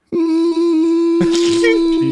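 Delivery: loud high cackle; loud high laugh. Retold or imitated. Imitating the laugh the teammate heard, loud. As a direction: let out a big loud laugh. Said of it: "all he heard was".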